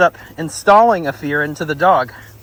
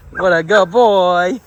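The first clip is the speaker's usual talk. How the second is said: slow, drawn-out, louder praise; gushing dog praise; 5 dB louder, slower than usual. Real, not acted. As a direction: stretch the words fondly for a dog. Fussing over another dog.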